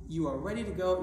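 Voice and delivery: ordinary talk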